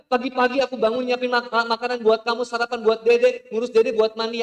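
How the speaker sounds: high, very loud shrieking rant; wife shrieks at her husband about housework; furious wife. Acted. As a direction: unload every grievance at full volume. Wife's furious shouted rant.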